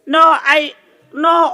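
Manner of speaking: ordinary talk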